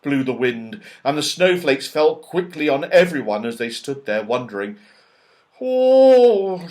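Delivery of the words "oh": shivering. Acted. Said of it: "shivered Moonface".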